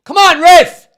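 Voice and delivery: high, loud appeal to the ref; pleading with the referee. Real, not acted. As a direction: yell it up at the referee. Shouting at the referee.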